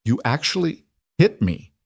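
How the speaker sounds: high, halting disbelief of a wounded man; wounded surprise. A narrator's reading. Lucas shot down, looking up at James, wounded surprise. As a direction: struggle the words out, pitched high.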